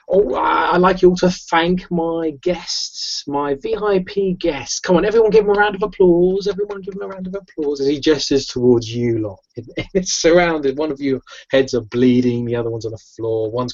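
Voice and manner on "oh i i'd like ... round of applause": high-pitched master of ceremonies; showman host. Acted. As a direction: work the crowd for applause, high and theatrical. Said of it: "He goes".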